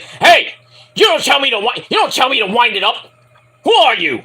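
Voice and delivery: high, loud indignant snap; mock a wrestler blowing up at a stagehand; pissy yell. Jarrett yelling at the stagehand, high and loud. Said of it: "He's like".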